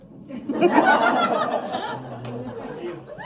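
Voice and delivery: high squealing laugh at her own joke. Real, not acted. Comic laughing at her own punchline, high giggle. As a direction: let a high giggle break out.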